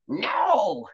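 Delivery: high, sharp refusal from the crone; spat refusal. Acted. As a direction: spit out the no. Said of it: "she spat".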